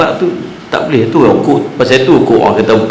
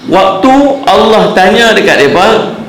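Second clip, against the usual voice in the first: emphatic preaching; 8 semitones higher than usual. Acted.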